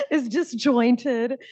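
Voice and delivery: slow, laughing through the words. Real, not acted. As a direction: finish the question through a laugh. Resumes question through laughter.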